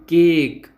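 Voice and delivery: केक said carefully with a long closed e, kek; demonstrated pronunciation. Shown as a demonstration. Teacher modelling for students how केक sounds, slow and careful. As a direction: draw out केक slowly so the e is heard. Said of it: "इसका उच्चारण ए होगा".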